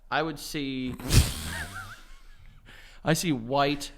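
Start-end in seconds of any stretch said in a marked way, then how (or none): 1.0-2.1 high laugh. Real, not acted. Laughs mid-sentence about grandparents.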